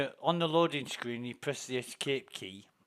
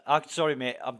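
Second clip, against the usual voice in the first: usual speaking voice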